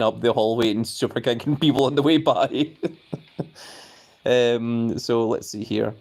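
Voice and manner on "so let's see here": ordinary talk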